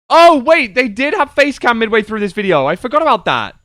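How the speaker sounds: surprised. Real, not acted. Sudden realization.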